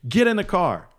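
high-pitched imitation of a scolding; mock tongue lashing. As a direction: scold him like an exasperated boss. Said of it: "gives me a tongue lashing".

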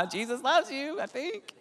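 high, strained pastor niceness; forced sweetness. Acted. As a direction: say it through a forced smile.

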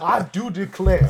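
slow, high Southern belle "I do declare"; southern accent. Pretend. Southern elf introducing himself, a Southern drawl. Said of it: "a southern accent".

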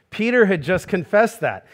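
ordinary talk, nothing marked

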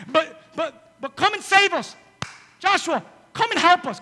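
high, loud desperate begging; desperate plea. Acted. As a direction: beg for rescue urgently. Pleading men of Gibeon.

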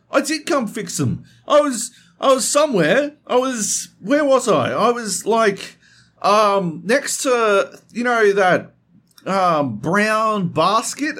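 flustered Heath imitation. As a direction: stall and fumble for an alibi.

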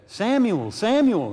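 high, loud call of a name. Acted. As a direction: call out loudly for the sleeping boy, pitch leaping up. Voice calling to sleeping Samuel.

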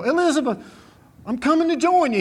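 mock heart-attack cry, pitched high and loud; hammed-up cry to his late wife as if his heart is giving out; Fred Sanford. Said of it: "old Fred Sanford, he go".

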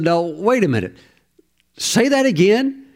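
lightly acted double take; amused disbelief. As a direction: pitch leaps up on a questioning request. Voices Jesus amused by apostle.

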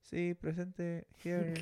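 small, high, soft pupil voice; mock roll-call answer. Fulgencio answering the roll call, meek put-on voice.

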